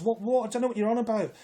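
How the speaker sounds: high, disbelieving what-what; incredulous protest. Retold or imitated. To the arresting police, high incredulous protest. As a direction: pitch jumps up in outrage.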